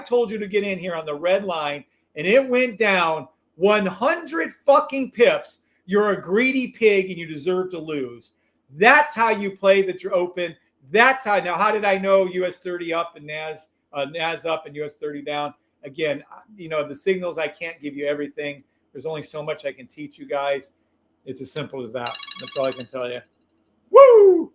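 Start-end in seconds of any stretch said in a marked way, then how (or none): 3.6-5.6 slow, loud and higher, each word punched; emphatic. Real, not acted. Boasting about profit. 23.9-24.5 very high, loud whoop of joy; celebratory whoop. Real, not acted. Celebration cry.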